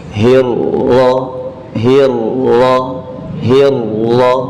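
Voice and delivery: hirroh with the r held as two, first silent then voiced; slow drilled hirroh; doubled ro. Shown as a demonstration. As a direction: say hirroh as hir-roh, a sukun ro then a fathah ro. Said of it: "imajinasikan seakan-akan ada dua ro".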